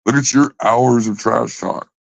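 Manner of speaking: ordinary talk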